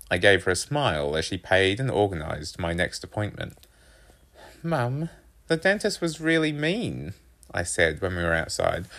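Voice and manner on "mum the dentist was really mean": put-on high-pitched boy's voice; complaining son. A narrator's reading. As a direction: tell on someone to Mum, voice raised high. Narrator voices complaining boy.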